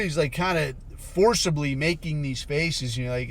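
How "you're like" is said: ordinary talk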